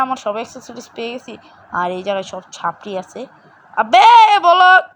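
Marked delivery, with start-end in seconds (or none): 3.8-4.9 loud, slow and higher shout of frustration; excited shout. Real, not acted. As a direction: yell it out, dragging the words. Shouting in a game fight. (উত্তেজিত জোরে চিৎকার)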